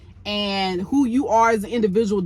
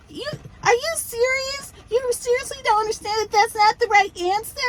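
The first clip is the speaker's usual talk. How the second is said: put-on much higher little-kid voice; baby voice; 12 semitones higher than usual. Host mocks Kimmy quizzing Maurice in a baby voice. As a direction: put on a childish voice, like a little girl. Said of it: "trying to talk like this little kid, baby voice".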